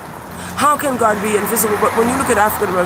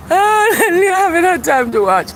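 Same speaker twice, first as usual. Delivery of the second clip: laughing; 10 semitones higher than usual. Real, not acted. Laughing at himself.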